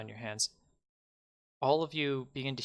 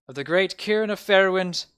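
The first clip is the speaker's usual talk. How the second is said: loud, raised proclamation, character voice not quite there; mayor's voice slipping; 7 semitones higher, 8 dB louder than usual. Acted. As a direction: proclaim it before the voice is found. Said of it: "I forgot his voice".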